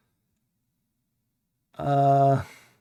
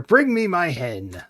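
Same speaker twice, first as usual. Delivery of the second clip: ogre demand; 4 semitones higher, 4 dB louder, slower than usual. Acted. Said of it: "shouts the ogre".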